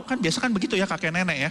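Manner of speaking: usual speaking voice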